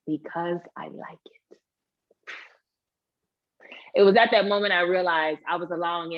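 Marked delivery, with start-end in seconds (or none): 0.1-1.6 slow, hushed confession; acted emotional whisper, barely voiced; tearful whisper. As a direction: whisper it slowly as if overcome. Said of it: "I start crying".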